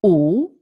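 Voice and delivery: U as a pure rounded oo; Italian U sound. Shown as a demonstration. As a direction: round the lips for a clean Italian U. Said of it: "vowel is U in Italian".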